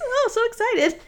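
high mock squeal; giddy excitement. Mocking her own delight over a slushie, giddy and excited. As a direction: play up the thrill in a high voice. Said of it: "I don't get as excited about major things".

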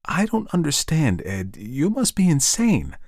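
high-pitched, drawn-out disbelief; bewildered Janet. Acted. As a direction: plead in confusion as his wife. Janet bewildered by Ed's claims.

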